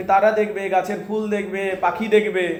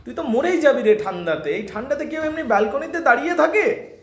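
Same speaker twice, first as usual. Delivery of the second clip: fretful mother; 6 semitones higher than usual. Acted. Mother fretting about cold.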